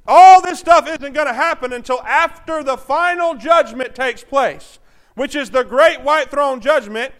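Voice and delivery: loud, driving sermon delivery; fiery preaching. Acted. Preacher warning the church of judgment, loud and driving. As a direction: preach it hard to the crowd.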